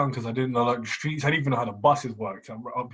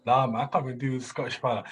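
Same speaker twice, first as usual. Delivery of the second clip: ordinary delivery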